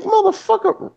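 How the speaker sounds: high squeaked exclamation; incredulous. Real, not acted. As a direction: squeal it in disbelief. Outraged reaction to hypocrites.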